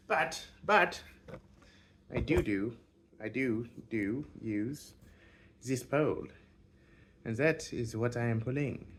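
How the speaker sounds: loud, hammed-up French accent; mock French accent, badly faked; terrible French accent. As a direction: put on a bad French accent, punching out the words. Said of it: "I'm doing a terrible French accent".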